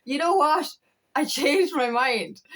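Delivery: imagined reply. Retold or imitated. Imagining her twin flame's reply, mock slow voice. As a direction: play the ex changing his mind, drawn out. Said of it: "It's like literally going".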